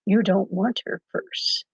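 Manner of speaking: slow, soft Jedi mind trick command; slow and soft, a Jedi talking down a mugger; Star Wars Jedi mind trick. Retold or imitated. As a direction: calmly wave it away like a Jedi. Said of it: "just like, uh, on the Star Wars movie".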